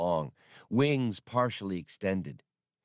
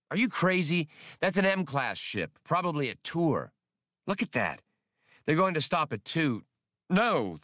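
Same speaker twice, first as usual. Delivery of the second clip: higher, incredulous protest; disbelieving outburst, pitched up; 6 semitones higher than usual. Acted. Han balks at the tour ship.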